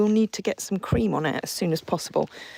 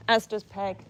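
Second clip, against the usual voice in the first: ordinary delivery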